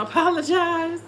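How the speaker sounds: ordinary talk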